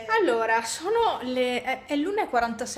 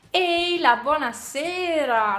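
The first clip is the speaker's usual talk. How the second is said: thin reedy voice; 5 semitones higher, 7 dB louder, slower than usual. Acted. Sing-song greeting to viewers.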